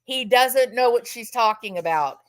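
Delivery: ordinary delivery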